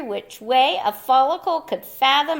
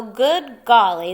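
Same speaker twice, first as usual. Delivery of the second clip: usual speaking voice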